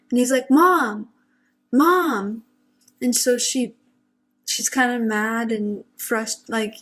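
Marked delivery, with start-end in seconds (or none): 0.5-2.4 retelling a panicked yell. Retold or imitated. Brother panicking at mom's door, loud panicked yelling. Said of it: "almost as if he's panicking".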